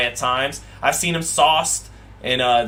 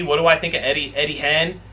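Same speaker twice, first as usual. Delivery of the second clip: normal talking voice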